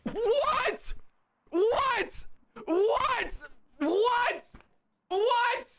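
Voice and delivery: high, stunned repeated what; shocked disbelief. Real, not acted. Streamer stunned by a donation, high and disbelieving. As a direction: gasp it out in total disbelief.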